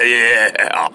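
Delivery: normal talking voice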